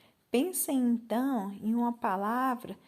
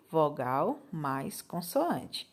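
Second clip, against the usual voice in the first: slow dictation; 6 semitones lower, much slower than usual. Shown as a demonstration. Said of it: "teacher explains legend slowly".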